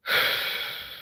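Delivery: long, unvoiced exhale; heavy breath out; weary sigh. Real, not acted. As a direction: let out a big sigh. Weary of the question.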